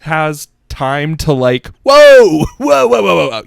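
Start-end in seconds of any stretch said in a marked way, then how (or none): 1.8-3.4 high, loud string of whoas; alarmed halting. Acted. Brain trying to catch up with words, shouting. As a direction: call out as if stopping a runaway horse. Acts out stopping own runaway mouth.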